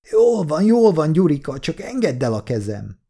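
high, pleading yelp, easing toward the end; whining squeal. A narrator's reading. Man with twisted arm begs Gyurika to let go, whining.

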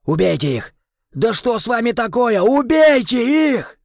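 furious shout, high and loud; angry shout. Acted. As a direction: roar the order. Krem orders the kill.

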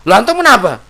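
high-pitched indignant challenge, rising into a shout; acted quarrel, pitched high. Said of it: "janganlah kita angkat suara tinggi-tinggi".

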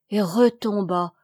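usual speaking voice

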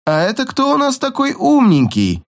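high mocking baby talk. A narrator's reading. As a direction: coo it nastily like to a toddler. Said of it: "Противно засюсюкал игрок".

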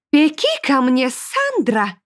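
high, slow, taunting threat; mocking demoness. A narrator's reading. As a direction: threaten with relish, stretching the words. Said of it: "издевательски сказала Берклея".

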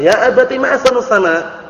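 lightly voiced young daughter calling to her father; daughter's mistaken words. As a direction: play the small girl gazing at the sky, getting it wrong. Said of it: "Anak perempuannya keliru mengucapkannya".

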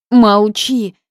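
loud, high sharp order; snapped order. A narrator's reading. Heroine silences the house spirit, loud sharp order. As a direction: cut him off hard.

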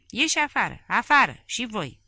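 high, loud furious shout; Ceaușescu throwing the officials out, yelling. A narrator's reading. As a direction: bellow them out of the room. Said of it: "a zbierat Ceaușescu".

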